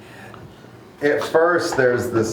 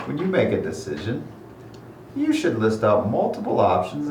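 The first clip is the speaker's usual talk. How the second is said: musing trickster deity, drawing out the advice; whimsical god; 4 semitones higher, 6 dB quieter than usual. Acted. God advises a follower to make it random, whimsical.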